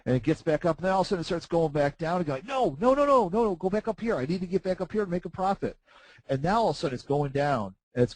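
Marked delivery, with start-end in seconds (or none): 2.5-5.8 high, desperate no no no; mock panicked pleading. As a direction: plead with the chart like it's falling away.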